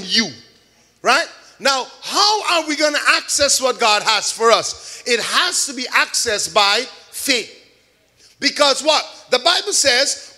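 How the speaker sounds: building, calling preacher's cadence; fervent sermon delivery; preaching. Acted. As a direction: preach it to the congregation. Preaching the faith message.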